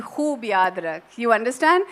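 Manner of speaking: normal talking voice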